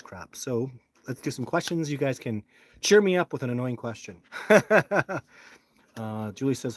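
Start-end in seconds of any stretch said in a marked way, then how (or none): 4.3-5.2 short laugh. Real, not acted. Laughs at annoying question.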